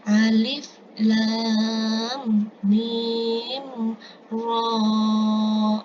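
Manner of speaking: letters of Alif Lam Mim Ra drawn out long; Quranic letter-by-letter recitation. Shown as a demonstration. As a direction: hold Lam, Mim and Ra on steady notes. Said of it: "Kemudian Ar-Ra'du ayat pertama".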